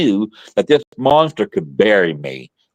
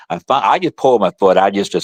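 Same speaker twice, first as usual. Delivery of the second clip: ordinary delivery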